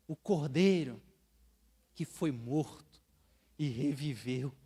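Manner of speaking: slow, building to a loud, high cry; exalted proclamation. Acted. Preacher proclaiming the Lamb worthy, building to loud cries. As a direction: build from hushed to a soaring shout.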